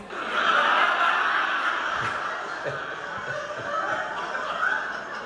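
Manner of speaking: breathless high-pitched laugh; real high laugh; high giggle. Real, not acted. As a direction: cracks up at own joke. Laughs at own rant.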